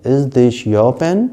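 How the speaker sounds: Is this your pen? slowly, pen rising; slow drill. Shown as a demonstration. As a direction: spell it out for learners. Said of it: "आपको repeat करना है". (धीमा अभ्यास वाक्य)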